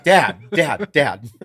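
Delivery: acting out an appalled son trying to interrupt; exasperated. Son's alarmed reaction to dad.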